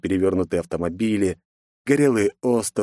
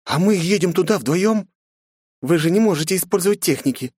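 higher female voice; young woman voice; 10 semitones higher than usual. Acted. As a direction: voice Grace, a little doubtful. Grace worried question.

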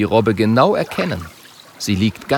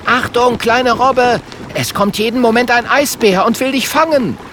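high, loud shout; high-pitched alarm; urgent warning call; 13 semitones higher, 4 dB louder than usual. A narrator's reading. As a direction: shout a warning to the little one. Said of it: "ruft Kokosnuss".

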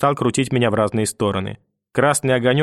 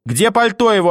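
higher and louder, insisting; louder demand; 9 semitones higher, 4 dB louder than usual. Acted. Grandmother repeats the demand for the coat, shouting. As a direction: shout the question now.

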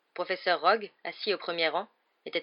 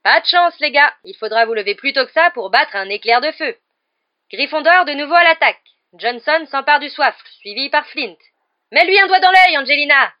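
high, loud play-by-play with taunts; gleeful partisan commentator; 8 semitones higher, 15 dB louder than usual. A narrator's reading. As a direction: taunt the rivals, then yell her on. Commentates and taunts as Lee Jordan. (commentateur railleur et survolté)

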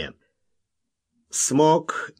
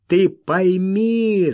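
loud, high, drawn-out plea; heated cry; 7 semitones higher, 5 dB louder, much slower than usual. A narrator's reading. Wild man begging Kid to understand, crying out heatedly. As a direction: cry out with fervour, stretching it. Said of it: "с жаром выкрикнул он".

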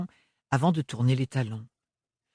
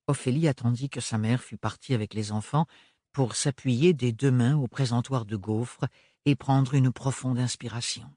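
usual speaking voice

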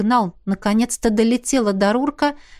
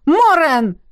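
calling out; 6 semitones higher, 5 dB louder than usual. A narrator's reading. Calling out to friend.

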